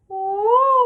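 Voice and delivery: high loud sighing ooh; light sigh. Shown as a demonstration. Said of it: "Repeating after me again".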